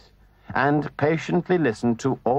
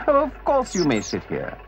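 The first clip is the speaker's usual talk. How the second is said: gentleman voice; 6 semitones higher than usual. Acted.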